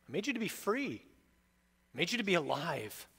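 reach out a hand and coax gently; Jesus's tender invitation. Acted.

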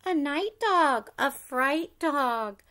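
ordinary delivery, nothing marked

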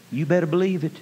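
ordinary delivery

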